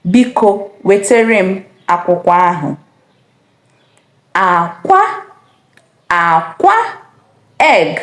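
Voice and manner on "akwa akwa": "Akwa" low, then rising; egg tone. Shown as a demonstration. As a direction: start "Akwa" low and jump up on the second syllable. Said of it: "different pronunciation".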